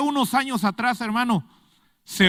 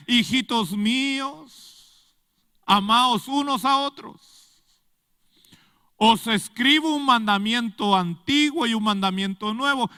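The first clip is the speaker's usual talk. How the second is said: tender apostle's plea; 5 semitones higher, 4 dB louder, slower than usual. Retold or imitated. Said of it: "en sus cartas escribía".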